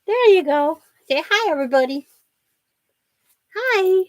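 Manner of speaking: put-on high-pitched baby talk. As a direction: lift it up to cheer the baby. Coaxing baby on video call.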